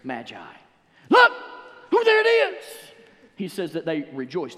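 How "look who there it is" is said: high, loud cry of discovery; joyful shout. Retold or imitated. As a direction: shout it like you just spotted it. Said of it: "they shouted".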